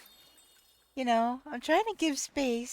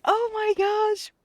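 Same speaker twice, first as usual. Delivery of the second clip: laughing exclamation; 9 semitones higher, 6 dB louder than usual. Real, not acted. Laughing at the crowding anglers.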